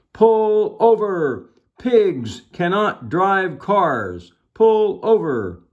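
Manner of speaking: high, loud, drawn-out bullhorn orders; bullhorn shout. A narrator's reading. Officer ordering a pig off the road, bullhorn shout. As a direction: holler each word slowly, falling at the end. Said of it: "shouted into his bullhorn".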